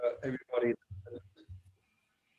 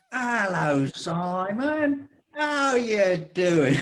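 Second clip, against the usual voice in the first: put-on Cockney accent, high and bright greeting; cheery London Cockney hello; English Cockney accent; 6 semitones higher, much slower than usual. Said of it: "in an English Cockney accent".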